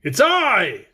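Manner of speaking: eager call through the door; crying out. Acted. Tin twins calling through the door, eager cry. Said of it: "cried the twins together".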